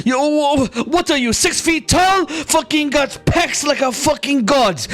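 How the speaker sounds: high-pitched incredulous berating; outraged rant. Acted. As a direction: tear into him, high and indignant. Rando berates Linton for cowardice.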